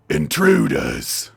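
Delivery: loud, slow, drawn-out bellow; enormous bellow. Acted. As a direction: roar the word out slowly.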